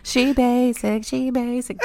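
high, slow sassy drawl; sassy teasing. Acted. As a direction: tease her with sass. Teasing friend as basic.

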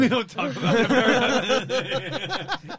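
laughing, slow and high; laughing repeat. Real, not acted. Amused host laughing at the guest's line.

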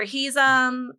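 ordinary talk

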